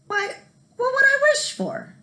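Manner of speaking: very high, loud puzzled question; surprised question. Acted. Jamie asks the leprechaun what to wish for, surprised. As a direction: ask in disbelief. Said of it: "Jamie asked".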